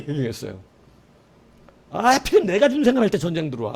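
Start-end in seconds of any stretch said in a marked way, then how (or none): 1.9-3.7 higher, louder fed-up groan; exasperated complaint. Retold or imitated. Soldier grumbling while pulling on uniform, exasperated. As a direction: complain bitterly about the timing. Same grumble repeated stronger.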